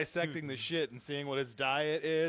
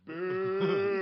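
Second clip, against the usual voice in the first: long background boo; heckling boo; 3 semitones higher than usual. Shown as a demonstration. Re-enacting heckling a friend on stage, drawn-out boo. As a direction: boo a flopped punchline from the back. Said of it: "I'm just in the background just".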